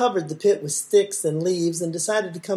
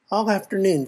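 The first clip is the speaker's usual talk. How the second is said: plain reading voice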